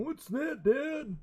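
put-on high, soft puzzled child voice; kids' puzzled voice. Kids asking their dad, high and puzzled. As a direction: imitate a confused kid. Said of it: "My kids are like".

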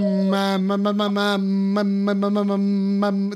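humming with nose plugged, like drowning; underwater. Real, not acted. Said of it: "I just sound like I'm drowning underwater".

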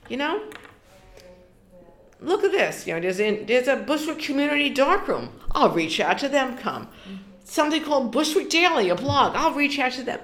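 high, eager rush of plans; buzzing with new ideas, pitch up; excited self-quote. Retold or imitated. Telling herself about Bushwick outlets, excited and high.

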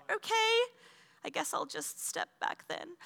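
high, small, soft voice; retold awkward little retreat; sheepish resignation. Retold sheepish retreat after nothing happened.

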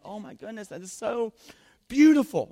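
high gushing delight, rising to loud; gushing dad. Retold or imitated. Father answering daughter's hug, high gushing delight. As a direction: melt over her like a smitten father. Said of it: "And I'm like".

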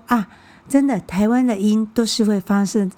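ordinary talk

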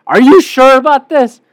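retold incredulous pushback; sceptical challenge. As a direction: question it loudly in disbelief. Retelling own sceptical reply.

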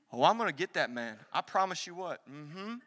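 put-on low, hushed girlfriend gossiping about payback; mock-menacing mutter, well under preaching pitch; scheming girlfriend. Preacher mimics women plotting revenge with girlfriends, low sassy mimicry. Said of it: "I don't know why I'm talking like this".

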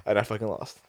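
ordinary talk, nothing marked

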